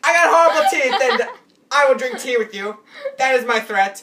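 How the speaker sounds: loud, high mock-British threat; hammed-up British accent, laughing at first. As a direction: put on a silly British accent and project. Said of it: "I should not speak in a British accent".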